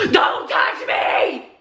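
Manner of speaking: harsh, drawn-out mimicked shout; explosive yell. Man reacting to her touch on his back, explosive yell. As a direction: imitate a man screaming to be left alone.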